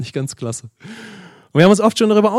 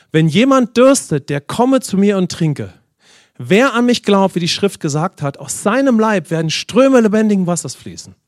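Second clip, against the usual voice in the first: scripture reading; 3 semitones higher, 3 dB louder than usual. Acted. Preacher reads Jesus' words. (langsam und gehoben vorgelesenes Bibelwort)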